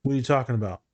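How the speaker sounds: usual speaking voice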